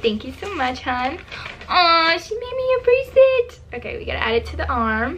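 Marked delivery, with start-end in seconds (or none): 1.7-3.6 high-pitched cooing "aw"; touched delight. Real, not acted. Vlogger opens a fan's homemade bracelet, touched delight. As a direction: gush over a sweet gift, pitched up.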